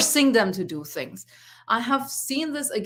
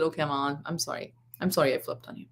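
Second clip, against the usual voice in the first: soft, lower apology; contrite; 5 semitones lower, 6 dB quieter than usual. Retold or imitated. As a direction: imitate a son sheepishly making up. Son's retold apology to mother.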